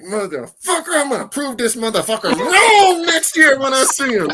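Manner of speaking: high, loud mock-furious outburst; hammed-up defiant vow. Artist vowing to prove his harsh critic wrong, hammed-up defiance. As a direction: hurl the vow, pitch soaring, half laughing.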